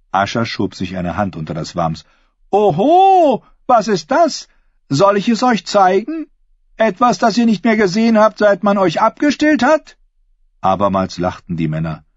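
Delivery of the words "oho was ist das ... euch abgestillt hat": high, loud mock surprise into teasing; ringing, high-pitched banter; bold woman taunting. A narrator's reading. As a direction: play to the crowd with a saucy challenge.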